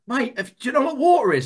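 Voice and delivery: high, earnest fish asking around; high young fish quizzing strangers in the sea; eager questioning fish. Acted. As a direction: pester every fish with the question, pitched high. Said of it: "asking all the fish that they encounter".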